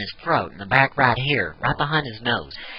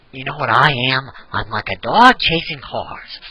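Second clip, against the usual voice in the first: Heath Ledger's Joker. Shown as a demonstration. Giving an example of the villain's voice, swinging pitch. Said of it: "Heath Ledger's Joker".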